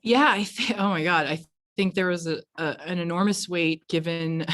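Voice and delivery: ordinary talk, nothing marked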